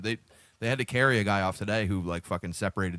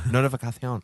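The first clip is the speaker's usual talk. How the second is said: Spanish accent; 3 semitones higher, 7 dB louder than usual. Shown as a demonstration. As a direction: repeat notificación in a hammy Spanish accent. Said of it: "that was a Spanish accent".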